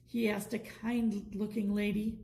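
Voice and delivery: plain reading voice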